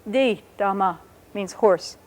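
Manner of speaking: "Di tama" slow, "Di" falling from high to low; falling pitch. Shown as a demonstration. Teacher sums up "Di tama", slow falling pitch. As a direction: let "Di" glide down slowly. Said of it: "it starts high and goes low".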